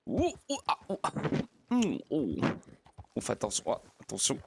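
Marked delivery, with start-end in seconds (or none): 0.1-2.4 cris de surprise. Real, not acted. Cheval fait un écart.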